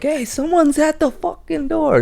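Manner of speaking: high, shrill yell up the stairs; mock yell. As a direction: sister yelling at her brother, imitated. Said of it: "she's yelling at her brother like".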